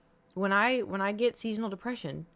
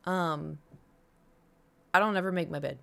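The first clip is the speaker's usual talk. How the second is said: ordinary talk, nothing marked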